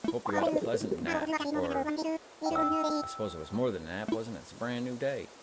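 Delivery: folksy shopkeeper with a southern lilt, pitch jumping up on the welcome; Tom Nook voice. Acted. Streamer voicing Tom Nook welcoming the player after a nap, southern lilt. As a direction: greet the sleepy islander like a cheerful southern landlord. Reading Tom Nook's dialogue.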